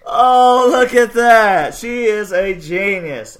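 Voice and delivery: high, loud, drawn-out crowing praise; mock amazement. Host praising co-host's plug, mock amazement.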